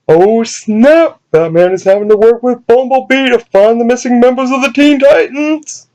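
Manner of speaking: high, excited fan gush; mock excited. Excited fan gushing about crossover.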